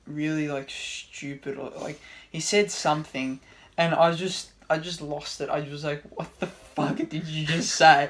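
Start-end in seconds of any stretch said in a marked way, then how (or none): 6.1-8.1 baffled, drawn-out question, slightly loud; retold bafflement. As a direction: act out losing it in confusion. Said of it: "I was so confused".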